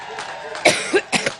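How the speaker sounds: long dry cough; coughing fit. Real, not acted. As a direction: cough through the pause. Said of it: "once I get over my allergic reaction".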